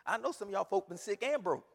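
normal talking voice

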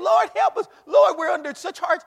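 high, loud mock wail; preacher mimicking the congregation crying to the Lord; mock cry for help. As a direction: ham up the desperate plea. Mocking people crying out.

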